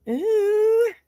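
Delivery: a delighted squeal at the gift; high excited ooh. Real, not acted. Host squealing with delight over a diamond member.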